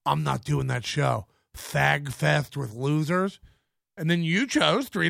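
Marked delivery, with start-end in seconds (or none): 0.0-3.4 mocking low voice. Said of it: "message me out of nowhere going".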